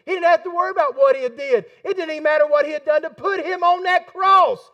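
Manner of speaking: building from firm to a near shout on the cross line; rising preacher shout. Real, not acted. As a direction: preach it out, climbing to full voice.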